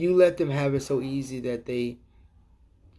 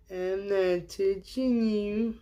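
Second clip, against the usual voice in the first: slow, softer singsong tease; taunting kid; 5 semitones higher, 4 dB quieter, much slower than usual. Retold or imitated. Kid teasing his sibling, slow smug singsong. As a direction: drawl the taunt like a smug brat. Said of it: "the Republicans are like".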